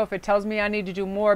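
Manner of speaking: usual speaking voice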